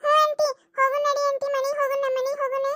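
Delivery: high, whining plea; tearful child. Acted. Little girl urging auntie to take her home, tearful. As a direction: urge through lingering tears. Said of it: "ಸುಮ್ನಾಗ ಅಳ್ಬೇಡ".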